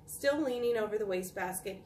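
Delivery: ordinary delivery, nothing marked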